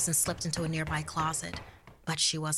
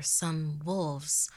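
plain reading voice

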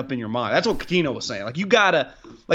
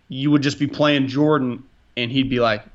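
ordinary talk

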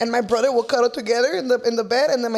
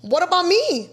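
higher, louder outraged protest; indignant; 8 semitones higher, 3 dB louder than usual. Real, not acted. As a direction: demand to know who's left holding the rent. Outraged at roommates leaving.

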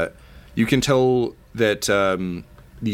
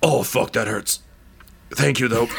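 dying man; 3 semitones higher than usual. Acted. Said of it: "and he goes".

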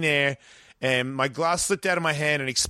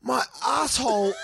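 anguished pained cry; 9 semitones higher, slower than usual. Retold or imitated. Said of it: "And then I'm like".

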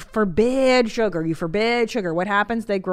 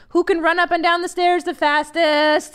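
put-on higher, louder, hyped-up dare to the kids; playful race challenge; 8 semitones higher, 4 dB louder than usual. Rallying pretend kids to race, excited. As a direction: pump them up to race the stairs.

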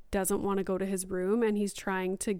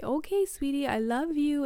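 lightly voiced loving mom, higher pitch; a hint of bedtime sweet-talk, pitched up; mom to child; 6 semitones higher than usual. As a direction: say it high, as to a little one. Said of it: "and then I say".

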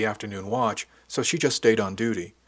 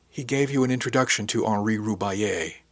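usual speaking voice

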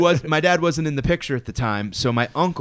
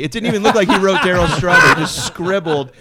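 fast, laughing and loud; 6 semitones higher, 8 dB louder, faster than usual. Real, not acted. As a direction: rush it out while laughing. Laughing about the scribbled autograph.

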